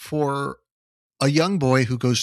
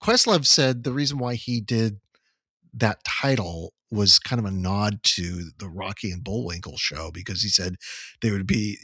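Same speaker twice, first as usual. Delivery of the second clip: normal talking voice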